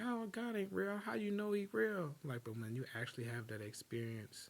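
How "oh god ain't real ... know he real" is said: higher, scoffing skeptic voice; mock the people who say God ain't real; mocking doubters. Imitating doubters who dismiss God, scoffing and higher. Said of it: "they're like".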